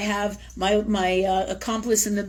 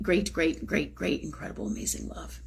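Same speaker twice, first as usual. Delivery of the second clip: hushed; 4 semitones lower, 7 dB quieter than usual. Real, not acted. Prayer sinks into whisper.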